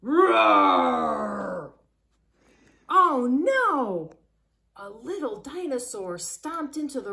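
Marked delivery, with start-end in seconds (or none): 0.0-1.7 loud, low, drawn-out roar; dinosaur roar. Acted. Reader voices a little dinosaur stomping in, loud roar. 2.9-4.1 loud, dramatic storybook dismay; mock alarm. Reader tells children a dinosaur has come, mock-alarmed cry.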